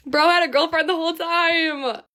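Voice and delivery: laugh, then cry it out high in disbelief; incredulous outburst. Real, not acted. Woman telling viewers about his secret girlfriend, high incredulous wail.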